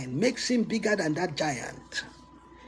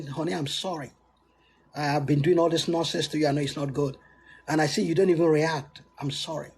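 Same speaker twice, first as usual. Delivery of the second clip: sorry husband; 7 semitones lower, faster than usual. Retold or imitated. Drunk husband bowing to his wife, apologising in a lower voice. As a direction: play the chastened husband, voice dropped low. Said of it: "he will come to you and bow and say".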